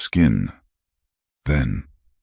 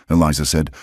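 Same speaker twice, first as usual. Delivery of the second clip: ordinary delivery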